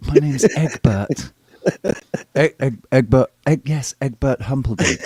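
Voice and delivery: hammed-up girl repeating her name. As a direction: spell out the name again in a prim falsetto.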